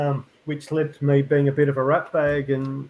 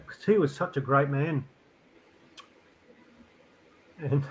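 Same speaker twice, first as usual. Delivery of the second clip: halting, tearful tribute with long pauses; real grief, held in; starting to cry; 3 dB quieter than usual. Real, not acted. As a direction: fight back tears between phrases. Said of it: "I'm just starting to cry".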